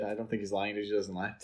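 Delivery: usual speaking voice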